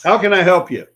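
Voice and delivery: a pushy clerk asking how he can help; eager salesperson. Retold or imitated. Clerk greeting a walk-in customer, eager and loud.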